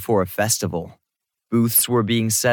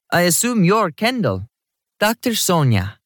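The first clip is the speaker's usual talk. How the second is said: put-on high-energy woman, higher Jamaican-accented voice; higher pitch, slight Jamaican accent; 9 semitones higher than usual. A narrator's reading. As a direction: introduce yourself briskly with a light Jamaican lilt. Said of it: "said in a slight Jamaican accent".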